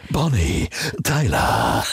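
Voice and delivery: lots of air in the voice; breathy, low, dying whisper-like. Retold or imitated. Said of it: "Viel Luft in der Stimme".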